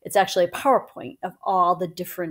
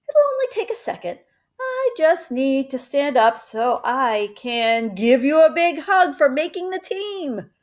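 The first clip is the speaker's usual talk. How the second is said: teasing mother; 6 semitones higher than usual. Acted. Mama teasing her son before revealing the news, high playful voice. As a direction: drag it out to tease him.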